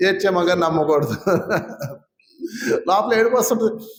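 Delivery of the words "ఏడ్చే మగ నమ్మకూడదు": mocking proverb. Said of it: "దిక్కుమాలిన సామెత కూడా ఉంది".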